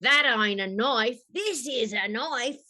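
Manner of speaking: broad Australian movie-quote impression; Crocodile Dundee. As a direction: put on a thick Aussie accent. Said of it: "Crocodile Dundee".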